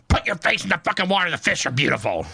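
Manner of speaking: mildly snippy imitation of a wife; shitty retort. Wife telling husband to look at fish, mildly shitty imitation. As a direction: retell her order with some irritation. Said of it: "she's all shitty".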